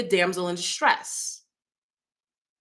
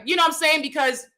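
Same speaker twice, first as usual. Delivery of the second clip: ordinary talk, nothing marked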